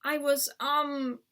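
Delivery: slow, drawn-out hesitant start; stammering. A narrator's reading. Boy caught outside by his dad, stammering. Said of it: "I stammered".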